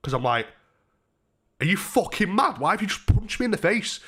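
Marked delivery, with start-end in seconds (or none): 1.6-4.0 higher-pitched indignant challenge; retold annoyance. Punched man confronting the lad, annoyed and incredulous. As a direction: act out outraged disbelief, pitched up. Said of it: "I got fucking annoyed then".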